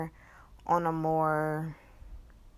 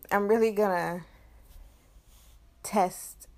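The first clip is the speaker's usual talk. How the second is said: ordinary talk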